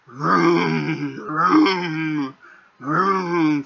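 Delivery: drawn-out mock engine vroom; mock revving engine noise; engine imitation. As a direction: imitate a revving car, mocking.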